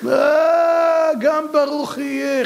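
high, slow, drawn-out cry of realization; acted exclamation. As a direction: exclaim it high, stretching the opening vowel. Acts out Isaac's reaction to Esau. (קריאת התפעלות גבוהה וממושכת)

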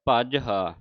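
ਭਜਹਾ as bhaj-haa, no vowel after j; correct pronunciation. Shown as a demonstration. Teacher saying pronounce it this way, correct pronunciation. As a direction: join j straight onto haa in ਭਜਹਾ. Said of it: "ਇਸ ਤਰ੍ਹਾਂ ਉਚਾਰਨ ਕਰਨਾ ਹੈ".

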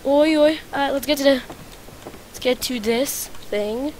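normal talking voice

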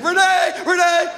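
high-pitched holler; mock urgent calling. Driver dodging branches, calling his head dog, high holler. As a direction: holler the name high, mid-action.

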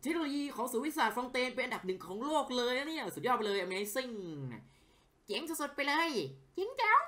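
overdone sarcastic wow, ending in a squeal; cheeky mock praise. Streamer teasing the game's science center, sarcastic gushing. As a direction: gush fake admiration to tease. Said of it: "ไอ้เหี้ยกวนตีนหน่อยดีกว่า".

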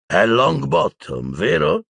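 smirking, drawn-out question; sneering. Acted. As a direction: draw out the name mockingly. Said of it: "ghignò Lucius Malfoy".

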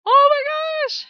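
high, loud, drawn-out oh my gosh; shocked high exclaim. Real, not acted. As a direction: gasp it out in shock.

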